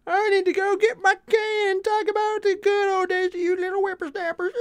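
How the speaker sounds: put-on high, creaky old geezer voice; old man voice. Streamer mocking himself as an old man, high old geezer voice. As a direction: grumble like a cranky grandpa. Joking about feeling old.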